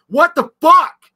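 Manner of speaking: loud, high, drawn-out outraged yell; retold yelling. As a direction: imitate a furious driver yelling out the window. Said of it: "Like, yelling".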